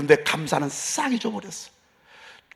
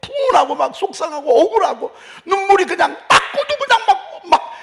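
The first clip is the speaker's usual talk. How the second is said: excited preaching; 10 semitones higher, 3 dB louder than usual. Real, not acted. Describing sobbing with laughter.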